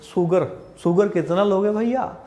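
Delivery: 'शुगर' said as 'soo-gar' with an s; mock local saying of 'शुगर' as 'soogar'; Bihar-UP pronunciation. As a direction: imitate regional 'शुगर' with s instead of sh. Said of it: "Bihar में".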